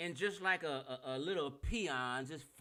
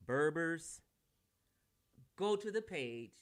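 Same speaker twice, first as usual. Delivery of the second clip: normal talking voice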